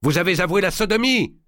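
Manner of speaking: accusation criée, très haute et forte; cri de colère. A narrator's reading. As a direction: hurler le démenti, tremblant de colère. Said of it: "dit Jean de Marigny". (Marigny crie au grand maître, furieux)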